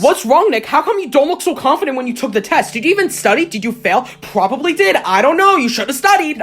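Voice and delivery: high, pressing teacher voice; interrogating teacher. Retold or imitated. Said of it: "my teacher interrogated me".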